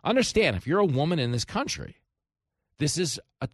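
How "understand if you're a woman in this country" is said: normal talking voice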